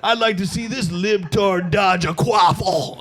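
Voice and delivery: slow, pompous shouted taunt; online tough guy. Retold or imitated. Anti-woke Twitter guy replying, slow mock shouted taunt. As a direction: sneer it like a flag-profile troll. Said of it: "that are just like".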